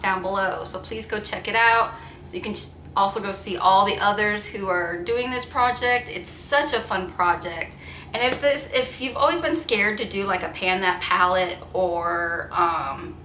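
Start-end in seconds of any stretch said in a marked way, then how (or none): none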